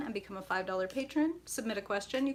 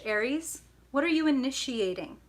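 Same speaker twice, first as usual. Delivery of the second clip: ordinary talk, nothing marked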